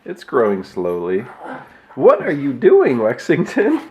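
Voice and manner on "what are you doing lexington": pet voice. Real, not acted. Calling to the cat.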